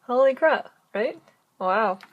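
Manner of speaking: loud, delighted outburst; excited. Real, not acted. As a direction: burst out with real delight. Thrilled about the full chain.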